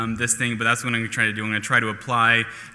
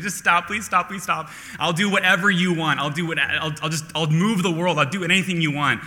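high, desperate begging, much higher; frantic pleading; 6 semitones higher than usual. Retold or imitated. Young man begging his crying girlfriend to stop, frantic and high. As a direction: plead to make the tears stop.

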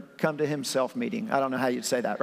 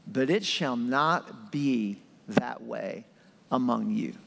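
stern but loving; 5 semitones lower, slower than usual. Retold or imitated. Jesus setting the disciples straight, stern yet loving. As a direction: lower and slow, stern with love. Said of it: "with a sternness and a love".

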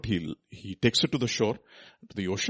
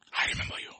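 soft, unvoiced whisper; 8 dB quieter, much faster than usual. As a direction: whisper it intimately to the listener. Same words repeated as whisper.

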